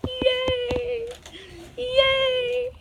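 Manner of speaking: high, squealed yay; excited cheer. Real, not acted. Reader cheering after her affirmation, squealing.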